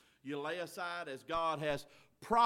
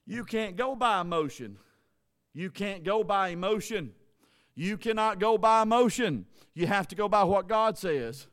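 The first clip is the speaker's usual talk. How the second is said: building repetition; 4 semitones higher, 11 dB louder than usual. Real, not acted. Repeated sermon point, building.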